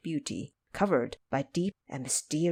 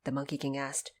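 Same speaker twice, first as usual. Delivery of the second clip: plain reading voice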